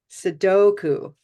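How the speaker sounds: Sudoku said slowly with the middle syllable stretched; stressed model word. Shown as a demonstration. Teacher modeling Sudoku for the class, slow and clear. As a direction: draw out the DO in Sudoku. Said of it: "if we say it in English".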